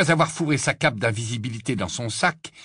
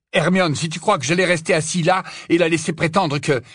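raised, higher-pitched retort; indignant protest; 8 semitones higher, 4 dB louder than usual. Acted. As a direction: protest heatedly until cut off. Harry argues with Hermione mid-fight. (protestation indignée, voix plus aiguë)

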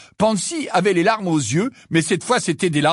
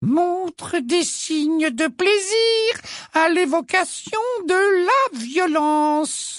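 very high, slow muttered dictation; high slow prim note-taking voice; Umbridge muttering notes; much slower than usual. A narrator's reading. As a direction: mutter it smugly while writing. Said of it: "marmonna Ombrage".

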